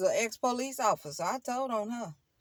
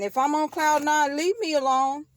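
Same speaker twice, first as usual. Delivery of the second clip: loud; 6 semitones higher, 6 dB louder than usual. Real, not acted. Defiant loud declaration.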